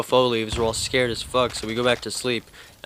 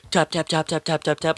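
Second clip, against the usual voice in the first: higher, light tapping sounds; imitated claw taps; 5 semitones higher than usual. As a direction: mimic little claws on the floor. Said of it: "little dog claws running across the floor".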